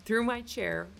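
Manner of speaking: ordinary talk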